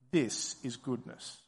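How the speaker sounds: low, soft, reflective statement; said to oneself. Acted. Preacher voicing what listeners should tell themselves, hushed. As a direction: say it quietly, as if to yourself. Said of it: "And then say to yourself".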